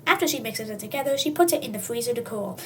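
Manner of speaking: usual speaking voice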